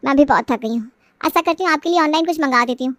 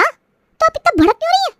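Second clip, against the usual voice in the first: indignant; 12 semitones higher than usual. Acted. Wife snaps back at sister-in-law.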